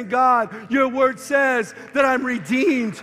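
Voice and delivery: loud, slow declarations of faith; shouted prayer. Retold or imitated. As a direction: proclaim each promise loudly and slowly. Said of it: "put your heart in God's word and say".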